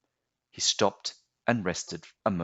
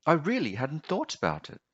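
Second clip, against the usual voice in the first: lightly voiced little boy, higher; a hint of a puzzled child; boy voice pitched up; young boy; 8 semitones higher than usual. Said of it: "the boy replied".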